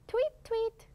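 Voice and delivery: high chirpy tweet, tweet; bird tweet imitation. Shown as a demonstration. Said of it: "some go".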